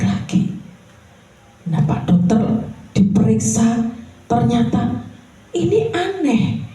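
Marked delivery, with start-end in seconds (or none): 5.5-6.7 high, astonished voice of a doctor; stunned doctor exclaiming; amazed doctor. Acted. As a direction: play the baffled doctor, pitched up.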